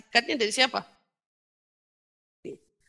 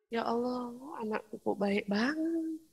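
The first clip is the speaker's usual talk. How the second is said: hushed, much softer aside of amazement; moved mother; 11 dB quieter than usual. Acted. Mother marvelling at how good her child is, hushed and moved. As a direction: drop right down, soft and touched.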